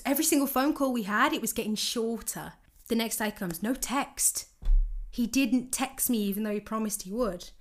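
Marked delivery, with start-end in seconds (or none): none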